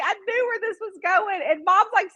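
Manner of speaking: ordinary talk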